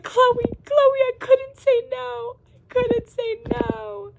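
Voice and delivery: high, loud, slow, drawn-out wailing plea; hammed-up despair, stretching every word; panicked wail. Running to Chloe, wailing that she couldn't say no.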